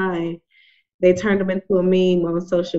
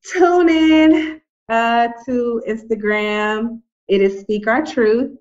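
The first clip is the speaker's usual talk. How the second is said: promo plug; 3 semitones higher, much slower than usual. Acted. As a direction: sell it like a radio plug. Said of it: "so I'm gonna use my little blurb".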